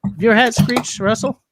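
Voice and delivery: calling out. Real, not acted. Asking a guest on stream.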